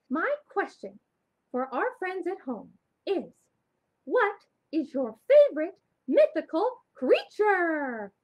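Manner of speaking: high, loud puppet voice with the question; puppet Shimmer. Acted. Shimmer the puppet quizzing friends at home, high and loud. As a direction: ask it like an excited puppet.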